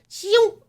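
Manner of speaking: high, loud whoosh; imitated vanishing noise. Shown as a demonstration. Showing friend how a wafer dissipates, high vocal whoosh. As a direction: let it zip away on a high pitch.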